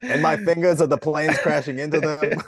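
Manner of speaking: a hint of blustering mayor; Rudy Giuliani. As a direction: carry on the mock politician bit. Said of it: "You can do Rudy".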